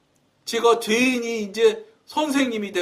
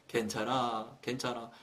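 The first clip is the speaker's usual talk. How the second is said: gentle reassurance; 11 semitones lower, 15 dB quieter than usual. Retold or imitated. Holy Spirit comforts the deacon.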